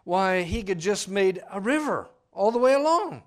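loud, high, driving sermon delivery; fiery preaching. Acted. Pastor to congregation, loud fiery preaching. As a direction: preach it out to the back pews.

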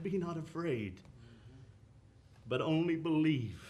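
calm, soft reassurance. A narrator's reading. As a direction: reassure a frightened father quietly. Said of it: "very calmly said".

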